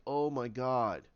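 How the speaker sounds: low, slow, dismayed; mild sadness. Acted. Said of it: "Sad beyond reasoning".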